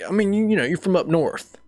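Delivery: imitated Southern buddy.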